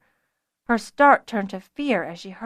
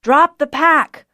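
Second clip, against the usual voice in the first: loud, higher, slow command; shouted order; 6 semitones higher, 10 dB louder, slower than usual. A narrator's reading. Archer ordering Fiona to drop the pack, slow loud shout.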